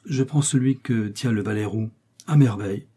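ordinary delivery, nothing marked

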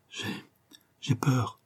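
halting, near-whispered fear; hushed, broken confession of fear; frightened stammer. A narrator's reading. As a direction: falter softly, as if afraid to speak. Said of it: "balbutia-t-elle".

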